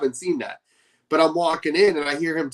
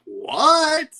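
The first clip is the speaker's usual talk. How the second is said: high, drawn-out disbelief; retold surprise; 9 semitones higher than usual. Retelling hearing Andrew Garfield is British too, surprised. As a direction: act out a high-pitched, stunned reaction.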